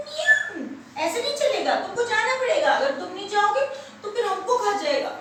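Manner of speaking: high-pitched, protesting animals; insistent refusal. Acted. Animals tell frightened rabbit he must go, insisting. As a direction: overrule him with a high, urgent insistence.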